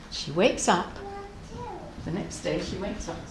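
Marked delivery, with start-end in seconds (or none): 2.0-3.2 very soft, low narration; barely audible storytelling hush. A narrator's reading. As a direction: tiptoe through the line. Teller hushes before the surprise.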